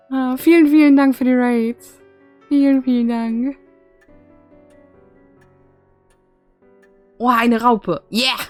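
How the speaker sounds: loud, high excited thanks. Real, not acted. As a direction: thank everyone with delight.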